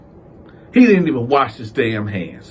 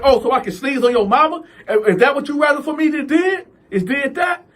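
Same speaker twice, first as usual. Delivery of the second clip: pitched-up taunting yell; high mocking shout; 11 semitones higher than usual. [name] to Calvin, a high mocking shout.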